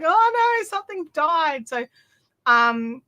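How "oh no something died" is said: high sudden mock panic; mock alarm. Vlogger retelling noticing broken gear, mock alarmed. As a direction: gasp out the discovery, hammed-up. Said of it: "I'm like".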